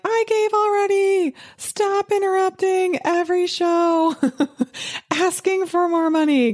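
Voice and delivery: high, exasperated mock listener voice; annoyed listener. A fed-up listener groaning at another pledge-drive appeal, high and exasperated. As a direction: whine like a fed-up radio listener. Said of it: "And you're like".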